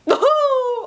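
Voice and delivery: high mock witch cackle; high hammed-up cackle; witchy cackle. Witch laugh after blurb.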